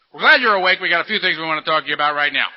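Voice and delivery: loud, hammed-up nagging head voice; a mock menacing voice in his head; inner critic voice. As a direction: bark it like a cruel inner boss. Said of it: "his head would say".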